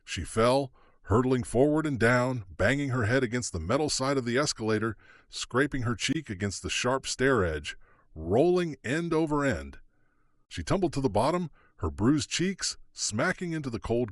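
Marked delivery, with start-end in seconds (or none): none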